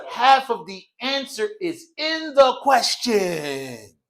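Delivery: very slow explaining voice; slow, deliberate. Shown as a demonstration. Teacher translating the saying slowly for class. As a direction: spell it out slowly. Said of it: "translating slowly".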